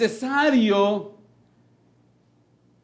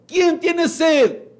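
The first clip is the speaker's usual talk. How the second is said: high, slow, raised call; preacher voicing Jesus shouting in the temple; the Lord shouting; 6 semitones higher, slower than usual. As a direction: cry out to the crowd in the temple. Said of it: "el Señor gritando, alzando la voz".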